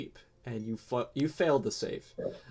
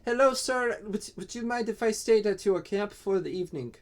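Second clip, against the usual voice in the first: higher, brighter creature greeting; eager kobold; 9 semitones higher, 3 dB louder than usual. Acted. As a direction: hail him politely as the kobold. Kobold greets second player.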